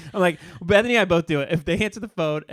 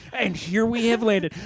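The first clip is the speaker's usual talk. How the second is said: slow, grand arrival announcement; mock announcer; 6 semitones higher, slower than usual. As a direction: announce the landing like a tour guide. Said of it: "was like".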